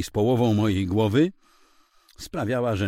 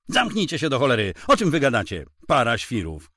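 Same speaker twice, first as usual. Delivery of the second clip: higher, louder, fast furious outburst; angry yell; 4 semitones higher, 4 dB louder than usual. Acted. Gunman to the bickering couple, a fast furious yell. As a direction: bark them into silence.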